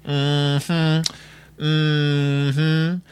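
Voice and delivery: drawn-out, level mm-hmm; pointed look. Acted. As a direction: hum it slowly at Mama, like a knowing judgement. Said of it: "gives Mama a look like".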